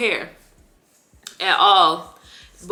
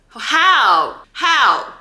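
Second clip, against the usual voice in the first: angry disbelief; 5 semitones higher, 5 dB louder than usual. Real, not acted. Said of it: "I'm so mad and I'm ranting".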